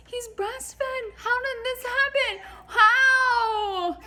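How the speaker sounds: very high, squealed panic; freaking out. Acted. Wife telling husband their baby is too little, hysterical panic. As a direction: build from panicked protest to a loud wail. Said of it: "I'm freaking out".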